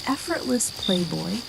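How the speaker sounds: usual speaking voice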